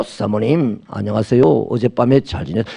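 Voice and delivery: a hint of a crooning flatterer; smooth-talking gigolo. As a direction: coax her gently like a charmer. Swallow sweet-talking again.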